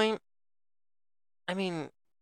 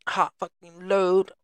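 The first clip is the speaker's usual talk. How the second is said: slow, louder, higher bro boast; hammy bro voice; 4 semitones higher, 5 dB louder, slower than usual. Pretend. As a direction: ham up a cocky bro.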